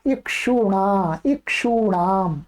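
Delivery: इक्षुणा then इक्षुणाम spelled out slowly, ण clear; slow careful pronunciation. Shown as a demonstration. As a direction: model each syllable for students. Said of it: "ये तो बता दिया आपको पहले ही".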